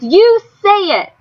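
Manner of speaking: high, slow demand; demanding scream. A narrator's reading. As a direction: force him to say it. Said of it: "Rob screamed".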